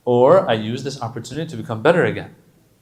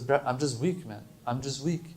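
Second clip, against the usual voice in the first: humble confession; 9 dB quieter than usual. Retold or imitated. Confessing weakness to Allah.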